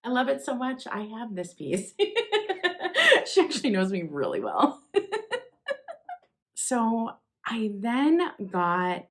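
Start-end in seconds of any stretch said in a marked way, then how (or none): 2.0-3.2 bright burst of laughter, up high; giggling happily at the gift; high delighted laugh. Real, not acted.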